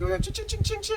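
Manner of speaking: very high, ringing ching noises; machete ching sounds. Retold or imitated. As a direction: mimic the blade striking. Imitating machete chopping sound.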